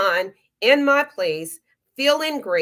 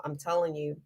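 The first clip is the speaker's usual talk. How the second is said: choked up; 4 semitones lower, 7 dB quieter than usual. Real, not acted. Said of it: "I can get emotional".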